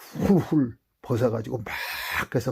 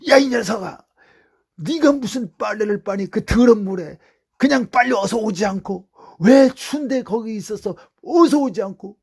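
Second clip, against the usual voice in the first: loud, high scolding mother's voice; 9 semitones higher, 8 dB louder than usual. Retold or imitated. Mother scolding her son for coming home late, loud and high. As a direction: scold him like a worried mother.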